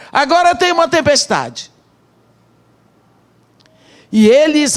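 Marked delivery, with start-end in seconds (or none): none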